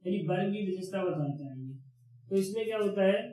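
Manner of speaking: ordinary delivery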